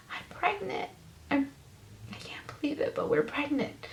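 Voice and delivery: breathless, whispered through tears; whispering the news to her husband through tears; tearful whisper. Retold or imitated. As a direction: barely voice it, overwhelmed. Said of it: "And I was crying".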